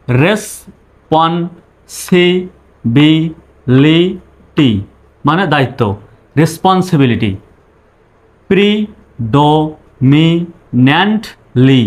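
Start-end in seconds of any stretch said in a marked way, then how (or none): 0.1-4.7 responsibility stretched long for repeating; slow call-and-repeat demo; very slow model. Shown as a demonstration. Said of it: "আমি বলবো, আমার পরে আপনি বলবেন". 8.5-11.8 drawn out for repeating; slow call-and-repeat demo; slow model. Shown as a demonstration. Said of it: "আমার পরে আপনি বলবেন".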